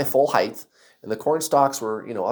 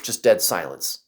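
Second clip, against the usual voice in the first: plain reading voice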